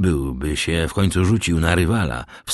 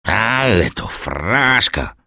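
slow, drawn-out tipsy dismissal; tipsy blurting; 3 semitones higher, slower than usual. A narrator's reading. Drunk Kaderus dismissing any misfortune, slow tipsy blurt. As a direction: stretch it out, waving the matter away. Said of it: "pludł bez tchu Kaderus".

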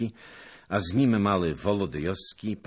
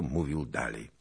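ordinary delivery, nothing marked

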